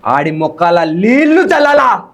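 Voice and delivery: slow, weighty movie dialogue delivery; dramatic Telugu movie dialogue on request; filmy villain dialogue. Shown as a demonstration. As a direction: deliver it like a menacing film hero line, slowly. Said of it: "ఒకసారి dialogue చెప్పవా".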